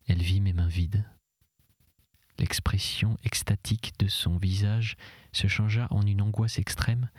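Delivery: ordinary delivery, nothing marked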